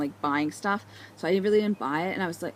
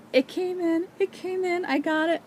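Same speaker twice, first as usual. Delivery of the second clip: excited; 10 semitones higher than usual. Real, not acted. Excited about yarn arriving.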